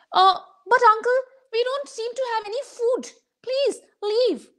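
put-on high little boy, fading to a whisper; small boy voice. Storyteller voicing the boy sending the traveler away, high then whispered. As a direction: start high and shy, then whisper please leave. Boy's voice refusing.

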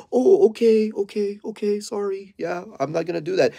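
high, timid voice of a dog giving in; meek backing-down dog. Retold or imitated. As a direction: play the dog caving under pressure. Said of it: "you see the dog".

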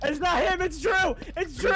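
shouting accusation. Acted. Player shouting to the group that Drew is the killer. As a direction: cry out the name in alarm. Yelling accusation during game.

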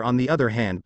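ordinary delivery

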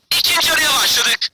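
normal talking voice

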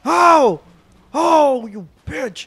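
high, loud pained yells; yelling in pain. Real, not acted. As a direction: cry out at each hit. Hit in game, yelling.

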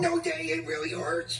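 put-on high soft strained kid whine; sore-throat whine. Puppet kid begs Daddy about his sore throat, soft whine. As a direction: whimper it like it hurts to talk.

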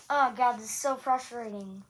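normal talking voice

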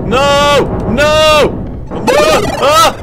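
real jump-scare panic; terrified screams. Real, not acted. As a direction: scream as it catches you. Being caught in game.

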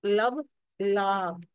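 लब short, लाब with the aa held extra long; short then stretched long. Shown as a demonstration. Teacher showing children the long vowel, slowly. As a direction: say लब quick, then drag out लाब. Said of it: "कुछ extra ही long कर लें".

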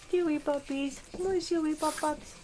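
normal talking voice

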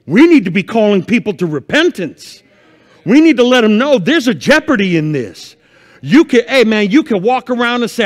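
loud, high, urgent sermon voice; preaching at full force. Acted. Pastor warning his church of the end times, preaching hard. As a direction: rouse the church with fire.

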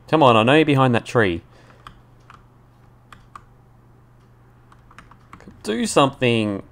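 calling out loud and high; loud taunting. Real, not acted. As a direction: goad the enemy, loud and frustrated. Shouting at hidden enemy.

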